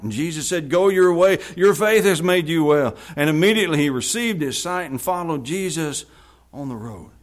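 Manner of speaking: projected Bible reading, building in force; scripture reading. Acted. Preacher reads the healing of the blind man, scripture reading. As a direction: proclaim the dialogue loudly, then settle.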